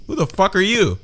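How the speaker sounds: put-on confused old man. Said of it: "He's like".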